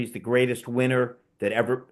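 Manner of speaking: ordinary talk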